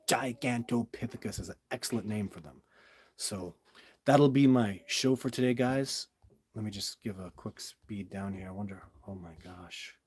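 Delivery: ordinary delivery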